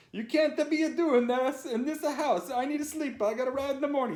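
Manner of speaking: high, loud, hammed-up Italian accent; heavy Italian accent. A narrator's reading. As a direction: screech a complaint in mock Italian English. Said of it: "saying in a heavy Italian accent".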